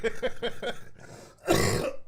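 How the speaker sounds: high, loud real laugh; loud laughter. Real, not acted. Laughing at her own retold story, a real loud laugh. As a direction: burst out laughing.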